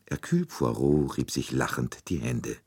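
ordinary delivery

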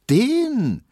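loud, high-pitched scorn; scoffing Berlin maid, voice shooting up; contemptuous. A narrator's reading. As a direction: pour disdain on the absent man. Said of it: "meinte Hedwig verächtlich".

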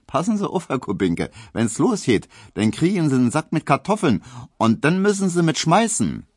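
high, emphatic Berlin wisecrack; dry wit. A narrator's reading. Hedwig teases her suitor about the army, dry wit. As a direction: deliver the jibe high and punchy, with dry wit. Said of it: "meinte Hedwig trocken".

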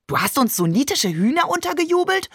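high-pitched incredulous question; playfully outraged, rising high; mock outrage. A narrator's reading. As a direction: pretend to be scandalised, voice climbing. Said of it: "deutete eine Ohrfeige an".

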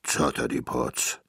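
low-voiced giant's made-up oath; deep giant voice; giant's exclamation. A narrator's reading. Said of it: "rief er".

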